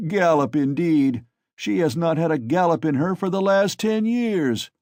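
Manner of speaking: a touch of loud scorn; scoffing heckle. A narrator's reading. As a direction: scoff at the idea. Crowd scoffs at the mare.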